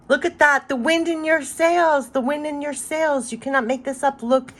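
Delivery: high-pitched, thrilled disbelief; amazed excitement. Real, not acted. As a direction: exclaim in real amazement at a perfect match. Amazed at the card she drew.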